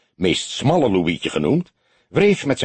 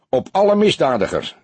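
joking toast; 6 semitones higher than usual. A narrator's reading. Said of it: "schertste hij vrolijk".

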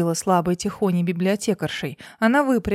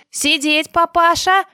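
roared command, much higher and louder; enraged boss roar; 9 semitones higher, 8 dB louder, slower than usual. Acted. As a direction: bark the order at full volume. Shouted order with gun.

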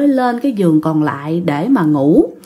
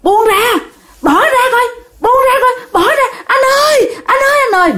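much higher, crying shout of panic; terrified cry for help; 13 semitones higher than usual. Retold or imitated. Mother pinned by a stranger, calling her sleeping husband, crying shout. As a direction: scream to be let go, voice breaking. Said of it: "mẹ mới la lên".

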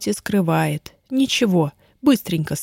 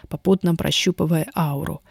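ordinary delivery

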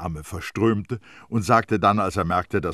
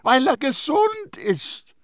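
very high, loud, slow exclamation; drawn-out emphatic shout; calling out; 9 dB louder, slower than usual. A narrator's reading. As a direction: cry it out in exasperation. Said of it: "rief Terrier".

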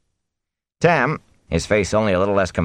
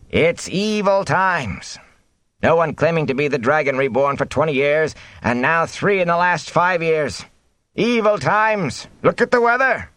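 high-pitched, doom-laden lament; villager crying out; 10 semitones higher than usual. A narrator's reading. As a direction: wail about bad times over the crowd. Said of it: "men and women shouting over one another".

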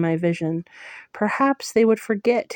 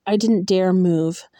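plain reading voice

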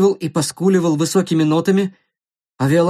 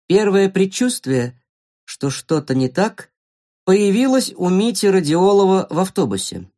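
plain reading voice

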